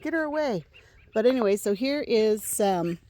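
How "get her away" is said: higher, lightly voiced dog panic; voicing the panicked dog. Owner voicing her dog spooked by a bird, lightly mock panic. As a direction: play the dog as mildly freaked out. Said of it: "She was like".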